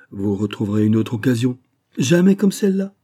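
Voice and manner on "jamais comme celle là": high, loud, emphatic denial; energetic affirmation. A narrator's reading. The sub-director insists to the prospectors, energetic. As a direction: insist hard, voice leaping up. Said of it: "affirma énergiquement".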